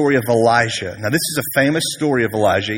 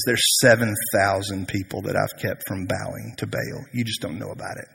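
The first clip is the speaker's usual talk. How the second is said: soft, lowered voice; quiet reassurance; 4 semitones lower, 8 dB quieter than usual. Retold or imitated. God telling Elijah of others, soft lowered reassurance. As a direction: let it sink softly, almost confiding.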